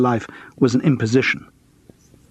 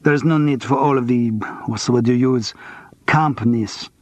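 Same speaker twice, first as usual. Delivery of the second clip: put-on heavy French accent, cool and clipped by resentment. A narrator's reading. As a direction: speak English as a curt Frenchwoman with a heavy accent. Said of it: "Her English was heavily accented".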